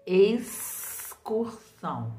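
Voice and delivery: "Excursão" bem devagar, sílaba por sílaba, com o X soando como S; pronúncia bem devagar. Shown as a demonstration. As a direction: estique "Excursão" para as crianças ouvirem o S. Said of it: "A letra X está representada pelo som". (professora soletrando "Excursão" devagar para a turma)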